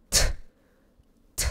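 "t" as a voiceless puff; bare t. Shown as a demonstration. Teacher tests it on paper, "t" as a puff of air. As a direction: release "t" with air only. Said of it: "Faz assim".

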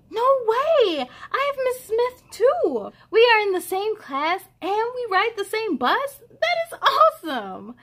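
excited. Acted. Boy thrilled at same class.

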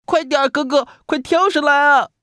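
very high, loud little-brother cry; urgent small-kid yell; loud urging shout. A narrator's reading. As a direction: urge big brother to jump, shouting high. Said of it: "弟弟大声叫着".

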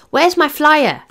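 high, loud demand. A narrator's reading. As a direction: call it out impatiently over the others. Friends shouting questions at Steve.

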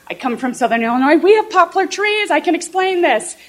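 a hint of a thrilled eight-year-old; eager excitement. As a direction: pitch up with childish eagerness, fast at first. Eager child's inner thought.

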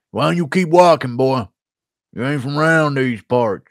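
hammed-up American Southern local; put-on Southern American twang; American Southern accent. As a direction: challenge a stranger in a Southern US accent. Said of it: "Give me your best American Southern accent".

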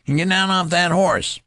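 put-on high, loud furious boy's threat; small boy furious at the colonel; ferocious voice. A narrator's reading. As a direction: spit it out ferociously in a treble voice.

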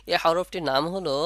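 ordinary talk

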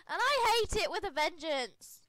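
emphatic rant; vehement dislike. Real, not acted. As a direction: hammer out how much you hate it. Ranting about hating ASMR.

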